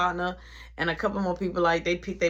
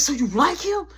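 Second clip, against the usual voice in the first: raised pitch, retold accusation; heated questioning; 7 semitones higher, 4 dB louder than usual. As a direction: act out confronting a partner. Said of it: "I'm yelling, I'm going off".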